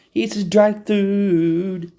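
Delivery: slow, high cooing for the cat; sing-song pet talk. Pretend. Owner cooing to his cat about dry food, slow and high. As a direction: coo it slowly like baby talk to a pet.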